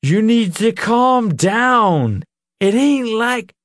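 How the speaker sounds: put-on slow slurred drunk speech; drunk slurring. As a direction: slur the words, drunk. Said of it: "his speech slurred".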